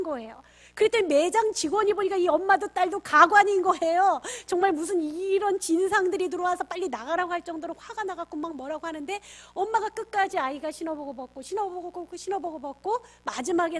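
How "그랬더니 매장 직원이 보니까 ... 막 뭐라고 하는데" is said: louder, rising indignation; heated storytelling. Real, not acted. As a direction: tell it with building heat. 화난 직원 이야기로 목소리 고조. (진상 손님 이야기에 목소리를 높이며)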